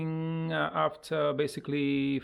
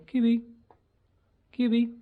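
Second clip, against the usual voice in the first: high, louder pet call; 8 semitones higher, 6 dB louder, faster than usual. Real, not acted. Owner calling parrot Kiwi to practise, high pet call. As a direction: chirp the name to a bird.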